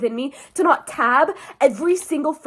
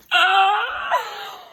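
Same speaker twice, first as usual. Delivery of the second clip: loud, high wailing "ah"; swooning scream; 9 semitones higher, 5 dB louder than usual. Real, not acted. Reader hearing a love declaration, swooning scream. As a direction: let out a high, loud cry.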